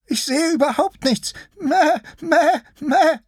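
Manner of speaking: very high, bleating little sheep voice; high-pitched timid lamb, begging; frightened sheep-lion. Acted. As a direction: plead in a tiny high voice, bleating loudly.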